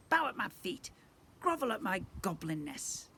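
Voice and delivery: squeaky goblin prince. Acted. Goblin prince greets the family, squeaky and pompous. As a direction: squeak out a smug insult. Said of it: "he said in a squeaky voice".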